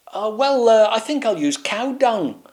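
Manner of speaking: high, hesitant grand voice; pompous knight. Acted. Swaggeralot answering Burpalot, high and hesitant. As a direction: bluff it out.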